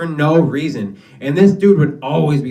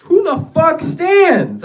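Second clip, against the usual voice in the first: high, loud, slow outraged squeal; mock outrage; 6 dB louder, much slower than usual. Imitating stunned classmates, high and loud outraged squeal. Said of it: "I'm like".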